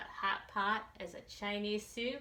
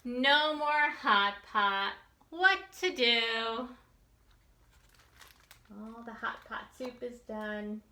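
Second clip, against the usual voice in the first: loud, lively read-aloud with slow punchy refrains; reading to children; 3 semitones higher, 8 dB louder than usual. A narrator's reading. Teacher reading a picture book to children, lively and loud. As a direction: read it big and bouncy for the kids.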